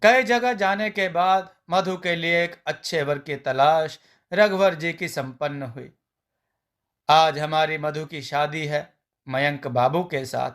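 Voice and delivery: ordinary delivery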